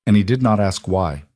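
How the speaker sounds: usual speaking voice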